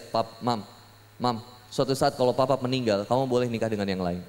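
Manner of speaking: low, soft, careful husband talk; tender parting words. Retold or imitated. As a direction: confide it gently to your wife. Tender talk to wife about death. (ucapan pelan dan lembut kepada istri)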